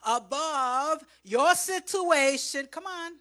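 loud, high climax; full-force preaching. Acted. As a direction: drive it home to the congregation.

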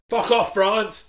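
hammed-up pub landlord rant; pub landlord impression. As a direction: toss it off like a pub bore. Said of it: "pretending to be the pub landlord dickhead".

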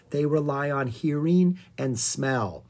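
usual speaking voice

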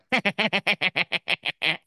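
high villainous cackle imitating a friend; evil cartoon laugh.

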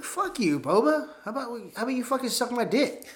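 villain taunt. Acted. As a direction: sneer it like a cocky gang boss. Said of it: "they were like".

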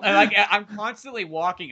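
ordinary talk, nothing marked

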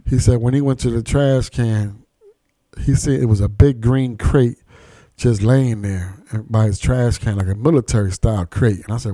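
usual speaking voice

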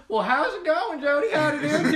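loud, high country howdy, mock Southern; overdone Southern accent, breaking into laughter; hammed-up Southern greeting. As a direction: holler a put-on Southern hello. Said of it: "the proper primary would be like".

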